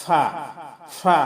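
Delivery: ordinary talk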